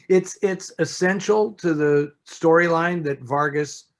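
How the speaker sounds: ordinary delivery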